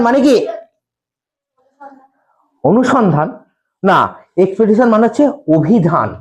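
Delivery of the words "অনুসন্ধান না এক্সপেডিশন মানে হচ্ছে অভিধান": ordinary talk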